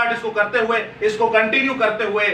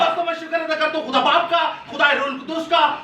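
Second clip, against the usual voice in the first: higher, driving, chanted litany of praise; preaching at full force; 5 semitones higher than usual. Real, not acted. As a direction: proclaim each phrase like a rallying cry.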